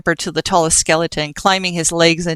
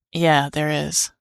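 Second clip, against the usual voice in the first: sighing; 2 semitones lower, 4 dB quieter, slower than usual. Acted. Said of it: "Dust sighed".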